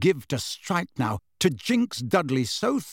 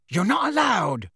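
higher-pitched indignant protest; indignant objection; 7 semitones higher, 3 dB louder than usual. A narrator's reading. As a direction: protest at once, pitch jumping up. Dudley objects at once, quarrel.

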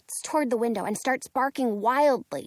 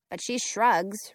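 ordinary delivery

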